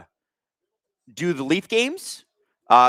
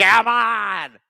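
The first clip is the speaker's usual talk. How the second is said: plush bird voice; 7 semitones higher, 3 dB louder than usual. Pretend. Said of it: "He's like".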